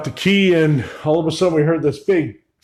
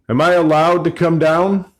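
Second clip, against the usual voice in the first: louder, higher call down below; 4 semitones higher, 5 dB louder than usual. Real, not acted. Visitor asking someone unseen whether he may come down, calling out. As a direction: call out for permission.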